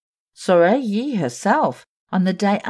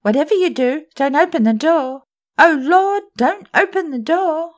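high, loud desperate begging; pleading in fear; 11 semitones higher, 6 dB louder than usual. Acted. Meg Giry begs Sorelli at the door, high and desperate. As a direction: beg her not to open it.

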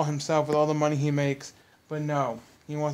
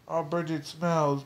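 mocking Michael; slower than usual. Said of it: "he's like".

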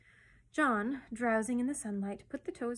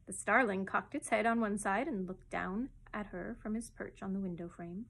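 ordinary delivery

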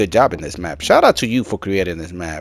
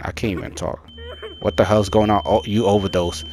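usual speaking voice

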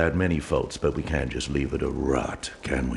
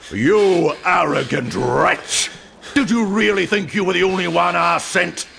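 furious contempt; 14 semitones higher, 6 dB louder than usual. Acted. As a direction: spit out each word at the fool. Pastor confronts the traitor.

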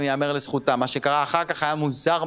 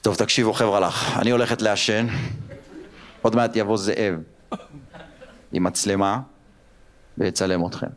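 offhand mom; 7 semitones lower than usual. Retold or imitated. Mom leaving to smoke.

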